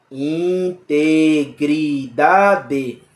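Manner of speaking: "Integridade" stretched very slowly, syllable by syllable; sounded out slowly. Shown as a demonstration. Teacher to class, drawing the word out very slowly. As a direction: drag out "Integridade", each syllable apart. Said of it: "Quantas sílabas?".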